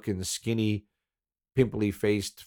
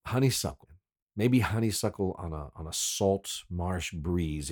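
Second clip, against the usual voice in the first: usual speaking voice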